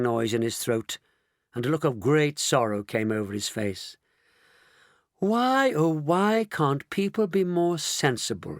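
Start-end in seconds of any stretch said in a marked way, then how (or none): 5.2-8.6 higher, mildly sorrowful lament; sad. A narrator's reading. Mister Wonka despairing of people, sadly. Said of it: "he said sadly".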